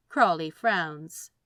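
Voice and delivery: ordinary delivery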